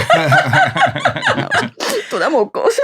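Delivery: squealing high laugh around the words; telling the talk show hosts about the premiere, laughing. Real, not acted. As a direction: laugh your way through it. Laughing through a retold memory.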